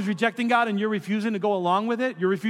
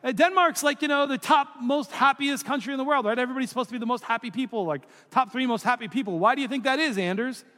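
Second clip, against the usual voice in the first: much higher, eager, fast-talking TV host; Phil impression; 7 semitones higher than usual. TV host quizzing Anders about happy Denmark, high and eager. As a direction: gush like an excited travel show host. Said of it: "'cause he talks like this".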